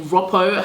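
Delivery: Roppo with a short first o, like "rop"; correct name pronunciation. Shown as a demonstration. Vlogger showing how primary school said her name, short-o Roppo. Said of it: "how it's supposed to be pronounced".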